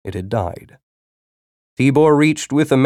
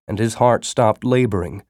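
ordinary delivery

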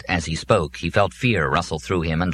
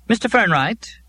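much higher, practiced secretary voice; practiced, capable woman on the line; competent female voice; 4 dB louder than usual. A narrator's reading. Secretary calls Joe on the robot's phone, practiced and competent. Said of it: "a practiced, competent female voice said".

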